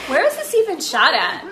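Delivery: ordinary talk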